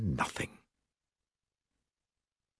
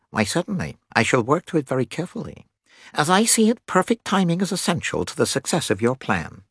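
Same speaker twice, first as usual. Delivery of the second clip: higher, brisk nasal character voice; keen nasal scientist; 10 semitones higher, faster than usual. Acted. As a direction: speak eagerly and precisely as a confident specialist.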